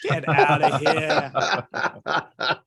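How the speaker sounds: drawn-out, laughing dismissal; laughing disbelief. Real, not acted. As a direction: stretch the words out through a real laugh. Laughing off guest's self-deprecating joke.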